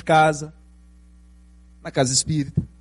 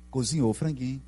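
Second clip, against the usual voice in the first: ordinary delivery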